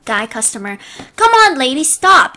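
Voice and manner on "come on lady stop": high, loud call to stop; fed-up shout. Acted. Customer telling Karen off.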